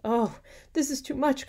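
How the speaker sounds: high, loud, theatrical outcry; overwhelmed cry. A narrator's reading. Hippolyta learns of her husband's crimes, overwhelmed cry. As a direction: cry out as if it's unbearable. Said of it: "cried Hippolyta".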